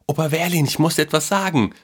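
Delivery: put-on high, eager boyish voice; boy's voice. A narrator's reading. Boy has news for grandpa, high and eager. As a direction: pipe up with news to tell. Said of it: "sagte der Junge".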